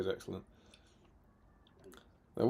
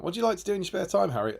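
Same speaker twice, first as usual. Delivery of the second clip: higher, fast quiz-host question; 6 semitones higher, much faster than usual. Acted. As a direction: fire it off like a game show host.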